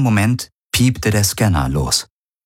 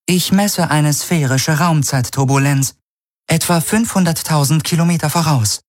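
much higher, even computer voice; ship computer; 10 semitones higher than usual. Acted. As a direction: report it like a calm onboard AI. Ship computer reports scan.

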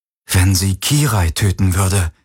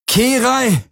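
high screamed name; desperate scream; 14 semitones higher than usual. Acted. Galen screams for Kirai through the door. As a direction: scream her name in panic. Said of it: "schrie er".

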